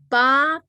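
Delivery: "ba" high and loud; tone demonstration. Shown as a demonstration. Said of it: "the pronunciation of the word here is".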